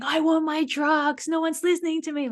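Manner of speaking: high, far-off shouting demand for drugs; role-play prisoner yelling, pitch jumped way up; pretend prisoner shouting. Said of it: "like, shouting and hitting the fence".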